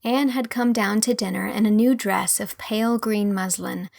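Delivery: ordinary delivery, nothing marked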